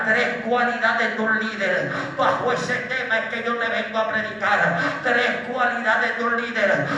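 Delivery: loud, driving preacher with punched praise interjections; full-force Pentecostal preaching. Acted. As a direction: fire up the congregation phrase by phrase. (predicación pentecostal a todo pulmón)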